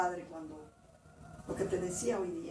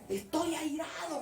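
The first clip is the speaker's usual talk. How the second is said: higher, stretched angry declaration, imitated; imitating God's strong voice; 6 semitones higher than usual. God repeats his anger to the praying woman, imitated strong voice. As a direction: imitate a wrathful God repeating himself, slowly.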